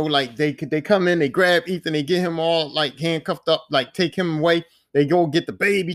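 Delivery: plain reading voice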